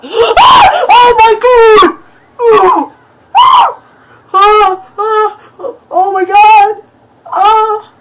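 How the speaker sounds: high, loud screams of terror; panicked screams. Real, not acted. Player attacked by the monster, panicked screams.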